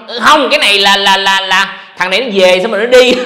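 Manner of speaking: loud scornful parody of an online troll; mocking a hater. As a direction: sneer out the hater's lame excuse.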